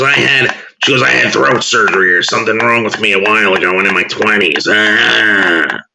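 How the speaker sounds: a put-on frog voice with a New England accent. Mocking Sherry K to Magda in a croaky New England accent. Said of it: "the worst fucking, uh, New England accent".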